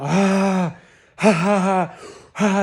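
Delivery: loud, forced ah then ha ha; release laughter. Shown as a demonstration. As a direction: let it all out loud. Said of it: "you're letting out".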